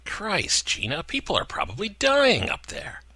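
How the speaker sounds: Craig, shocked. A narrator's reading. Craig rebukes Gina.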